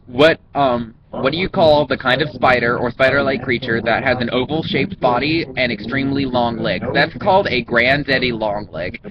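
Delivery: ordinary talk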